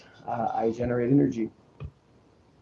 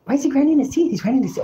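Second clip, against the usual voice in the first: sharply higher mocking imitation; gossiping onlookers; 11 semitones higher, faster than usual. People talking about the man lying down, high mimicked gossip. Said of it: "I could hear people saying".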